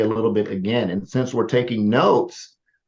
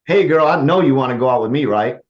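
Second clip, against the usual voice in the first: louder, sure, swaggering; assumptive confident tone; 3 semitones higher, 6 dB louder than usual. Shown as a demonstration. Coach shows the confident assumptive ask. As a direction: say it like she already said yes.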